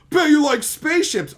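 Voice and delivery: high, loud put-on voice; mock taunt. Imitating a critic's jab, high and loud. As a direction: taunt it smugly.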